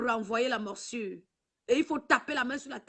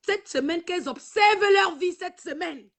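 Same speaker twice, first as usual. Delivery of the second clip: loud warning; 5 semitones higher, 7 dB louder than usual. Real, not acted. Avertit ses ennemies à la caméra.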